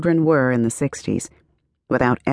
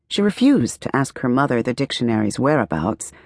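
plain reading voice

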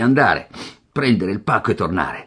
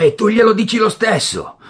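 stern; 13 semitones higher, 6 dB louder than usual. Acted. Father scolding son.

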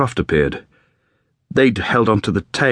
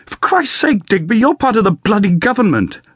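much higher and louder, furious and accusing; angry outburst; 12 semitones higher, 3 dB louder than usual. A narrator's reading. Wounded pilot rounds on Digby over the lost crews, furious. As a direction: let the anger burst out, voice cracking high. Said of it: "Bart's mood changed from despair to anger".

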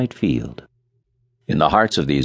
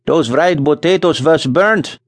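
put-on slow Scandinavian-accented grumble, higher; 9 semitones higher, 4 dB louder, slower than usual. A narrator's reading. Nels complains to Charlie in a Scandinavian accent. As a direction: Scandinavian settler grumbling over burnt potatoes. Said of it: "said the other, wielding his knife vigorously".

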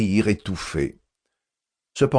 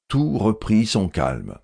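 plain reading voice

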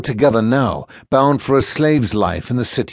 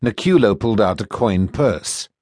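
plain reading voice

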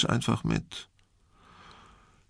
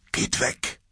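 low-voiced urgent warning; hushed warning. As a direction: warn them under your breath. Teacher warns pupils of approaching vampire.

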